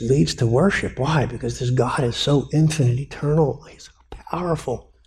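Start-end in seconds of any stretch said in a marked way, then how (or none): 3.6-4.9 hushed whisper of awe; awed whisper. Real, not acted. Preacher awed by God's power before the church, whispering. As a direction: breathe it out in wonder.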